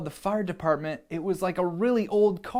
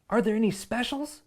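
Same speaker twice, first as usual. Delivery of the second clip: put-on eager boy voice; 6 semitones higher, faster than usual. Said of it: "I asked".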